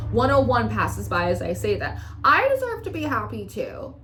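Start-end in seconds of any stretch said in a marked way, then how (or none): 2.2-3.9 high-pitched, plaintive claim; plaintive insistence. Acted. Reader repeating the querent's claim to happiness, high plaintive insistence. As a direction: insist on your right, pitched up.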